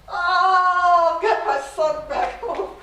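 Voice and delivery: loud, laughing cry of relief; joyful shout. Acted. Mother reunited with her son, laughing joyful shout. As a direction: shout it out through happy laughter.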